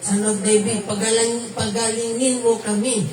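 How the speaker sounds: high-pitched plea with no real shout; half-hearted mock cry. Shown as a demonstration. Preacher mimicking blind men crying out to Jesus, mock feeble cry. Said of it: "Yun ba ang sigaw nila?".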